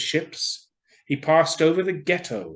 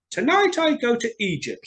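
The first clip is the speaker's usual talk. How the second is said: high, bright-eyed bird announcing his trip; the swallow, in high spirits, announces his trip to Egypt; excited swallow; 11 semitones higher, 5 dB louder than usual. A narrator's reading. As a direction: sing out the plan with glee.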